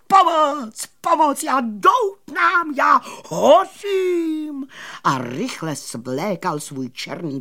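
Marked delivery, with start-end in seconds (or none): 0.1-4.7 high, loud cries for help, vowels stretched; panicked shouting. A narrator's reading. Magician crying for help while smouldering, high panicked shout. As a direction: yell it like your coat is burning. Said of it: "křičel".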